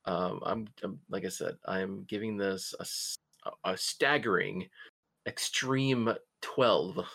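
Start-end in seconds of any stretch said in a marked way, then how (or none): 5.3-7.0 slow, drawn-out big reveal; grand announcement of the rating; dramatic reveal. Real, not acted. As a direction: savour each word like a drumroll payoff.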